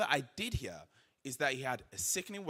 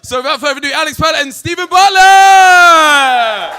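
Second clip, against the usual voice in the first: boxing-announcer style introduction; 13 semitones higher, 27 dB louder than usual. Real, not acted. Announces speakers to stage.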